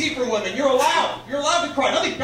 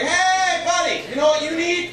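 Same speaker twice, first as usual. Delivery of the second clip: high, raised mock boss voice; confrontational boss; 7 semitones higher than usual. Boss to a crying male employee, confrontational. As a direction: call him out as the boss, pitched up. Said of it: "The boss comes by, he's like".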